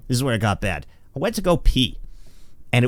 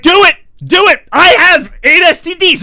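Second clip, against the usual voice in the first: loud, high, hammed-up egging-on; goading woman; 10 semitones higher, 7 dB louder than usual. Imagined girl drinking in Atlantic City, goading shout. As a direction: shout it as a wild dare, stretching the boast.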